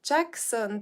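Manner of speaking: ordinary talk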